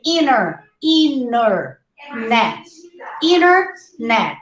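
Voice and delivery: Internet said as inner-net; silent T. Shown as a demonstration. Teacher models Internet with the T left out. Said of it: "try not to pronounce the T".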